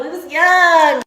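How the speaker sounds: drawn-out loud yell of the same words; slow shout. Shown as a demonstration. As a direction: belt it out slowly, hamming it up. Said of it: "Please stop".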